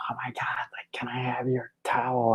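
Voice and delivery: eager breathless whisper; whispered request. Retold or imitated. Starstruck fan whispering a request for the towel.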